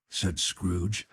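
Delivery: usual speaking voice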